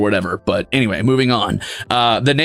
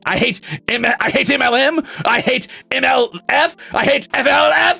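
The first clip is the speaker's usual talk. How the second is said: building mock rage; 9 semitones higher than usual. Real, not acted. Escalating joke hate list.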